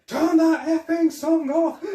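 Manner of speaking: high, slow, laughing shout; hammed-up angry yell over loud music; mock furious yell. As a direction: bellow it through laughter like an annoyed cop. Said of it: "looking around going".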